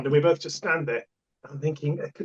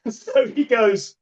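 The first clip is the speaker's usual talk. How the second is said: ordinary delivery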